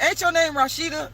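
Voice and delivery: high, friendly respectful ask; imitating him politely; nice polite version. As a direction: say it kindly this time. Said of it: "He was very nice and respectful".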